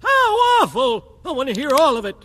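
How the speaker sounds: ordinary delivery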